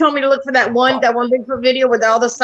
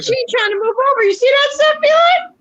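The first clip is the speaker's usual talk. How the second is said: high teasing; 11 semitones higher than usual. Real, not acted.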